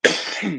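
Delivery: short chesty cough. Real, not acted. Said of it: "Sorry for coughing in your face".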